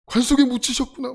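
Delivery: overplayed sobbing grief; mock wailing. Boy pretending his mother died, mock weeping lament. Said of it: "전 더 울어 막".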